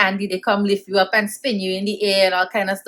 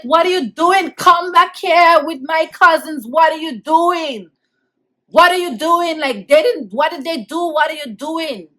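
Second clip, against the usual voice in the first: retold desperate shouting; 7 semitones higher, 4 dB louder than usual. Child to police carrying cousins away, high desperate calling. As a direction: call after them in re-enacted distress.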